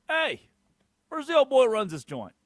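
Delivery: loud, high-pitched Southern salesman; southern code switch. Acted. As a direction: holler in as a Southern good old boy. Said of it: "a southern code switch".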